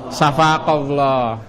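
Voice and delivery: syafaqallah stretched slowly, each syllable clear; slow demo. Shown as a demonstration. Teacher shows students the prayer to a male friend, slowly. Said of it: "Kalau mendoakan kamu laki-laki".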